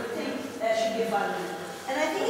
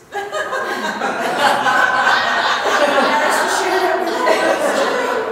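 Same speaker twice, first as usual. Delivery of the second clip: loud, higher real laughing fit; long loud laughter; 8 semitones higher, 12 dB louder than usual. Real, not acted. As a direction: dissolve into laughter. Long laugh after Japanese insult.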